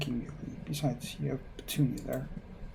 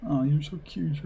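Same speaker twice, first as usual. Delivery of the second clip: put-on fawning girlfriend; 2 semitones higher, 4 dB louder, slower than usual. Girlfriend fawns over her boyfriend at the bar, cooing. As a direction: gush over him like a smitten teen.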